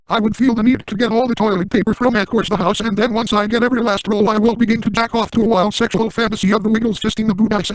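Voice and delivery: ordinary delivery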